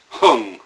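Hung pushed out hard and loud, air expelled; "hung" as a sharp loud exhale from the belly; "hung" punched out with all the breath; forceful Hung. Shown as a demonstration. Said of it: "so it's gonna become a sort of like Hung".

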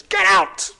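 high, sudden bellow; full-throated comic yell; shouted command. Acted. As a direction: blast it out like a bossy troll. Pleased troll shouts command fourth time.

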